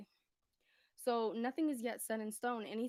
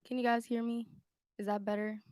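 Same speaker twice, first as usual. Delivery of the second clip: raised voice, checking to be heard; loud mic check; 8 dB louder than usual. Real, not acted. Streamer asking silent viewers if they hear, loudly. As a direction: project it at the microphone. Testing whether the mic picks up.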